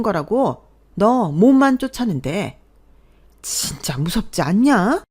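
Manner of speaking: ranting mother-in-law. Acted. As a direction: pour scorn on the daughter-in-law. Mother-in-law rants to her son. (분통 터뜨리는 시어머니)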